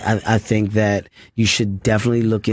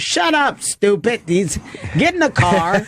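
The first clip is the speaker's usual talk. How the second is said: much higher mock friend voice, breezy and dismissive; imitating Keith; 9 semitones higher than usual. Keith brushes off the young comic's rejection, high mock voice. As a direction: toss it off like nothing matters, pitched way up. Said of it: "And Keith was like".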